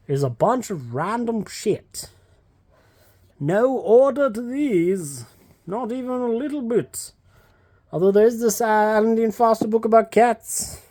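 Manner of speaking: lightly high, breathy-free character voice of Willow; a hint of a whimsical fantasy heroine voice. Vlogger touring her bookshelves in a playful Willow voice. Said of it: "everything in a Willow voice today".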